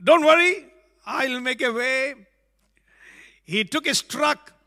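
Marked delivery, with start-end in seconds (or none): 0.0-2.2 high, loud, bright promise; father reassuring. Retold or imitated. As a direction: call out to the son. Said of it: "The father said".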